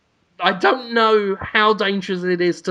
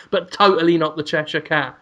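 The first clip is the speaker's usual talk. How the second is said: ordinary talk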